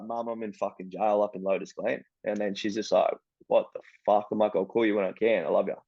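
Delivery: low and subdued phone call. Retold or imitated. First jail phone call to mum.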